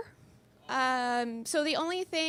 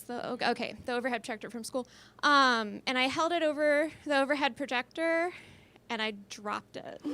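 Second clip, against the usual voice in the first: usual speaking voice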